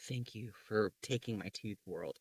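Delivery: quiet, slow mock gratitude; soft and slow. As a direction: murmur it slowly, almost to yourself. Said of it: "most of the teeth I lost were, like".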